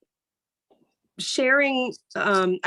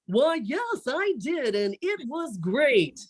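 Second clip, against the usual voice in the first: a stiff, higher, overly proper answer, slowing at the end; formal anchor-style reply; 6 semitones higher, slower than usual. Retold or imitated. Friend answering about a TV show, mock anchor formality. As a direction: answer a friend like a newscaster would. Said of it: "No, they don't talk like that".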